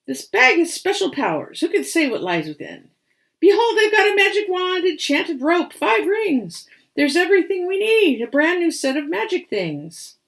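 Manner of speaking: high, loud showy boast, speeding up; cheeky showman. Acted. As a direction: Birdie proudly showing off his magic bag. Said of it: "with a cheeky badger grin".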